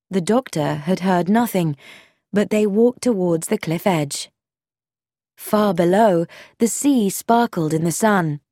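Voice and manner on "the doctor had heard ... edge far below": usual speaking voice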